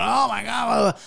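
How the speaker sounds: high strained cry of pain; a half-joking howl of pain; mock pained yell. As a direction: yelp like the needle hurts. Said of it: "like, going".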